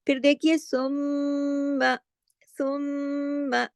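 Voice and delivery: सुम्मा with a long nasal doubled meem; full ghunna. Shown as a demonstration. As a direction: hold the meem of सुम्मा in the nose. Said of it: "मीम पे तशदीद है".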